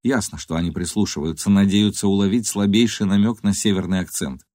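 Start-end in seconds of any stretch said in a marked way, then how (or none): none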